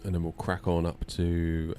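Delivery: usual speaking voice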